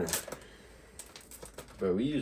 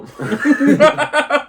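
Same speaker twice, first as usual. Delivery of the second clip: laughing loud and high, slowly; half-shouted through laughter; 15 dB louder than usual. Real, not acted. Joking reaction to eating worm.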